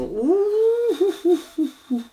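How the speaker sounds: long high "ooh" breaking into short hoots; high-pitched oohing. Acted. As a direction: let out drawn-out high oohs. Cartomancien découvre ce que ça sent.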